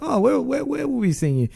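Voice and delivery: high, soft, innocent eagerness; friendly. Retold or imitated. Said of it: "genuinely just being friendly".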